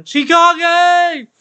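mock announcer yell. Playful drawn-out yell in stream.

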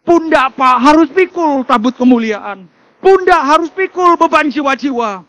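stretched, forceful preacher's cry; full-force preaching. Real, not acted. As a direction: proclaim it loudly to the whole congregation.

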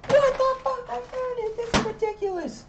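very high, loud disbelieving yell; high exasperated yelp; frantic shout. Retold or imitated. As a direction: shriek in amazed frustration. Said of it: "I'm like".

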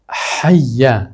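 حیه stretched very slowly, the ح soft and breathy. Shown as a demonstration. Teacher modelling حیه for students, very slow and soft. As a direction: draw out حیه with a light throat h.

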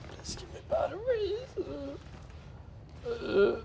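high, soft pleading; desperate plea. Real, not acted. Gamer pleading quietly for batteries. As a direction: beg softly and high.

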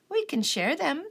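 high, gentle suggestion; friendly ladybug voice. A narrator's reading. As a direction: offer it kindly. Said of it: "suggested the friendly ladybug".

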